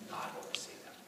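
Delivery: soft whispered repeat; whispered echo. Acted. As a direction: breathe the promise quietly. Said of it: "whispered echo".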